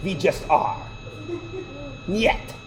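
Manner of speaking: lightly imitated Russian accent. Daughter answering dad, mock Russian accent. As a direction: mimic a child's pretend Russian replies. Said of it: "speak to each other in Russian accents".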